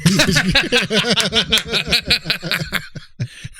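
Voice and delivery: big high laugh; hearty laugh. Real, not acted. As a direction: burst out laughing. Laughs after joke.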